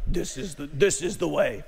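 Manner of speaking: a hint of a low, solemn Mandalorian voice, lightly done; mock the helmeted warrior's grave motto; Mandalorian line. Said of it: "I can't even say it like they do".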